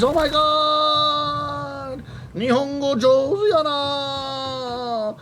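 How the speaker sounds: high, slow, gushing amazement; gushing shop lady. Retold or imitated. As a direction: gush like an astonished shop clerk. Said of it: "She said".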